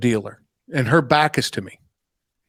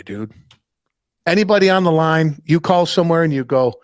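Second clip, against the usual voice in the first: usual speaking voice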